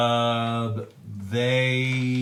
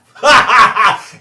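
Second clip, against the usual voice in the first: loud cackle breaking out; monster laugh; 8 semitones higher, 14 dB louder than usual. Acted. Game master voicing the monster laughing at the acid. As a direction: burst into a loud, mocking laugh. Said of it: "he starts to laugh".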